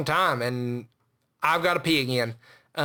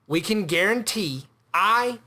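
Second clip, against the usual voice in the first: ordinary talk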